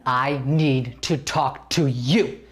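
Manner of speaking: slow, heavy, deliberate menace; slow stern demo. Shown as a demonstration. Acting coach shows the student how to say the line, slow and stern. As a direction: talk down to him like he's five. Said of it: "Make him feel like he's five".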